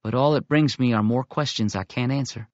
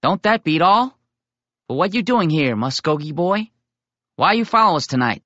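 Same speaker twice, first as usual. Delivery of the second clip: higher, louder good-natured ribbing; amused teasing; 6 semitones higher, 4 dB louder than usual. Acted. Little Coon teases Tommy about following them, amused. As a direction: tease him like an older boy.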